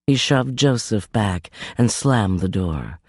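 ordinary delivery, nothing marked

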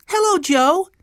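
put-on high, loud little-boy voice; high-pitched child's greeting; little boy Benny. A narrator's reading. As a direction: pipe up like an eager small child.

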